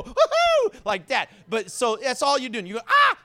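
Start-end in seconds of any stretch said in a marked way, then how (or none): 0.0-0.7 high, loud "Woohoo!" whoop; demonstrating an ecstatic church holler; Pentecostal shout. Shown as a demonstration. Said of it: "call it a Pentecostal shout". 2.9-3.2 short, high, loud "Ah!" squeal; sudden squeal as if just tagged; quick squeal. Shown as a demonstration. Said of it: "do a little squeal".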